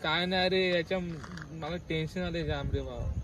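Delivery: usual speaking voice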